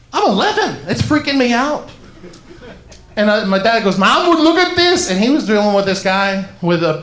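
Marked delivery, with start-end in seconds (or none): 0.1-1.9 put-on higher, protesting kid's alarm; exaggerated squeamish distress; mock freaked out. Eleven-year-old watching skull surgery, mock alarm. Voicing his alarmed child self. 4.0-5.1 eager dad. Retold or imitated. Surgeon dad calling his squeamish son over, high and eager.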